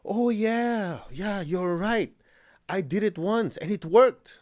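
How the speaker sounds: high, slow gushing excitement; excited. Retold or imitated. Imitating an overexcited student on the phone. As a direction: gush like she just discovered magic. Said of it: "She's so excited".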